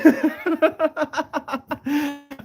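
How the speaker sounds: high, loud run of laughter; real laughter. Real, not acted. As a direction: burst out laughing at your own story. Laughs at own awkward story.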